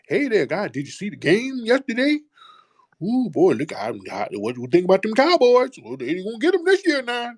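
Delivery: high, swooping, hammed-up chummy small talk; grinning, eager-to-please worker. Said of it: "skinning and grinning with every tooth showing".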